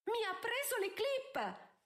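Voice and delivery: high-pitched, drawn-out indignant shriek; shrill out an accusation, slowly and high. A narrator's reading. Countess accusing someone of stealing her clips, shrieking. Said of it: "strillò Araminta".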